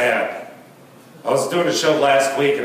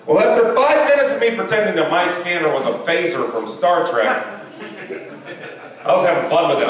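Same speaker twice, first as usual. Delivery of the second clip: ordinary talk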